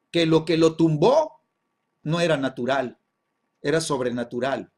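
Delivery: ordinary talk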